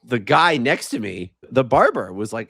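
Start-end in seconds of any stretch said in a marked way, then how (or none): none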